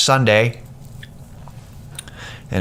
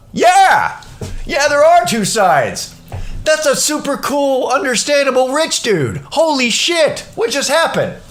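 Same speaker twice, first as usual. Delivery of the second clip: excited disbelief; 14 semitones higher, slower than usual. Retold or imitated. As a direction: gush in amazed disbelief. Stunned by the reasonable rich man.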